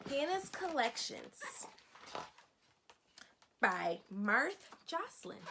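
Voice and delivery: expressive picture-book narration for a class; storybook read-aloud. Acted. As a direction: lively, clear reading to young children. Teacher reads picture book aloud.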